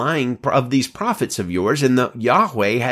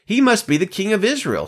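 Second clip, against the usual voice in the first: shouted; 11 semitones higher than usual. A narrator's reading. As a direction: call it out high, a restrained narrator's shout. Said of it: "they shouted".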